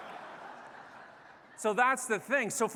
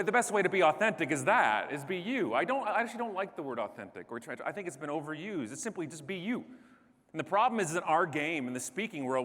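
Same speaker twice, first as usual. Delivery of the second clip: ordinary talk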